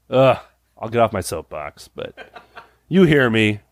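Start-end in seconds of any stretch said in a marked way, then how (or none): none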